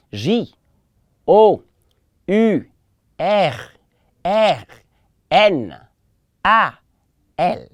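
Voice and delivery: J O U R N A L as separate French letter names; measured classroom spelling of "J O U R N A L" in French; spelled out. Shown as a demonstration. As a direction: spell "J O U R N A L" letter by letter, pausing after each. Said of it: "Let's spell it".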